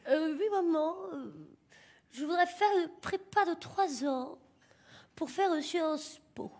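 slow, prissy imitation of the son; hammed-up prim son asking mother; affected son. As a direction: drag it out like a posh little son. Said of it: "Il se donne un petit air".

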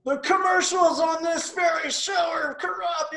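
put-on high, loud ranting old senator; Bernie shouting. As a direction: rant it at the top of your voice. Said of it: "Bernie will sit there ... and shout".

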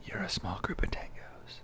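very soft, slow whisper. Real, not acted. Teasing the teammate back about the tangos, whispering. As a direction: whisper it back slowly, barely audible. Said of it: "But why are we whispering?".

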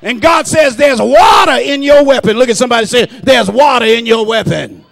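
loud, driving preacher's proclamation; rousing pulpit shout; full-force preaching. Real, not acted. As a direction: build to a shouted charge to the congregation.

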